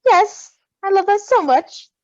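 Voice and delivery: loud, high squeal of joy; gushing delight. Real, not acted. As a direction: burst out with delight.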